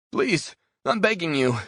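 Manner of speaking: high, loud pleading cry; desperate begging. Acted. Prisoner in silo begs the man with the knife, desperate plea. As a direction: beg for mercy in terror.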